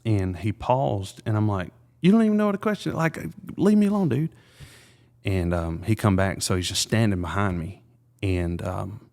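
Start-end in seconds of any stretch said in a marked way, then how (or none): 2.0-4.3 much higher, mock-frustrated squeal at the kid; high-pitched exasperated dad brushing his son off; exasperated outburst. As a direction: play the overwhelmed dad snapping, voice shooting up high. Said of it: "I got frustrated".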